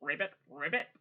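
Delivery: low croaky ribbit; frog croak. Pretend. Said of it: "sound like a frog".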